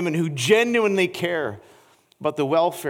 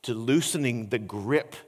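quiet earnest preaching; 8 semitones lower, 7 dB quieter than usual. Acted. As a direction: draw them in close and confess gently. Intimate quiet preaching section.

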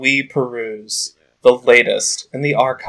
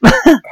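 high laugh; 4 dB louder than usual. Real, not acted. Laughing at the reading.

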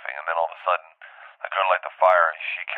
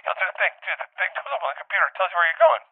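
imitating the director; 13 semitones higher, faster than usual. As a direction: ham up the smug director flying the plane. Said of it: "He goes".

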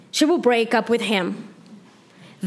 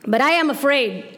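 usual speaking voice

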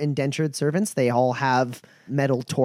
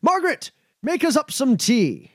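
shouting into the house; 10 semitones higher than usual. Acted. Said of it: "then he shouts".

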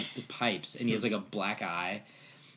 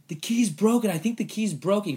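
high, louder mock alarm; alarmed announcement; 10 semitones higher, 6 dB louder than usual. Player telling teammates the key snapped, alarmed and high. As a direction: blurt it out, pitched up.

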